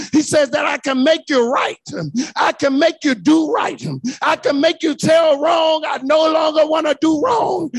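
loud chanted climax over the music; preaching at full force. Acted. Preacher whooping at the climax to the congregation, full force.

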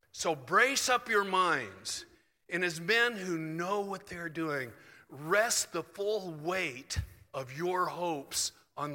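slow, weighty proclamation; emphatic scripture reading. Acted. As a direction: draw out each phrase of the verse. Reads Phillips translation aloud emphatically.

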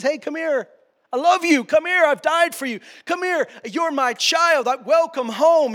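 loud, high, pleading call of God; God calling you home. Acted. God calling a lost child home, loud and pleading. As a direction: call them home with open arms, slowing at the end. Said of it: "He says".

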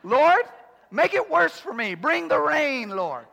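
high, loud, hammed-up plea to heaven; a loud mock prayer, laughing at itself; mock fervent prayer. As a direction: cry out to the Lord as if begging for trouble. Mock prayer for a harder storm.

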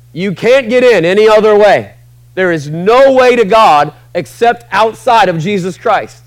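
emphatic preaching. Real, not acted. Preacher declaring the one way to God, emphatic and loud. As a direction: push it out high and loud.

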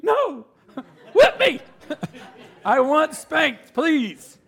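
high, slow pleading through laughter; laughing mock begging. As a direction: beg to be spanked, cracking up.

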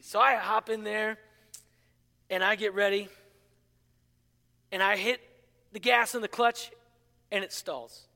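ordinary talk, nothing marked

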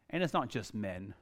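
usual speaking voice

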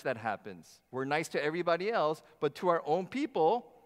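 ordinary talk

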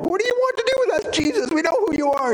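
very high-pitched, fast mock screech; hammed-up demonic scream without full volume; imitated scream. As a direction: screech it like a man possessed. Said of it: "suddenly the guy's screaming out".

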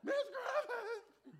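high, drawn-out scream through laughter; laughing mock scream. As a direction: replay the scream while cracking up.

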